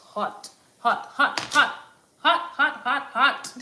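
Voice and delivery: rising excited chant of hot; bouncy, rising repeated hot. Real, not acted. Bread just out of oven.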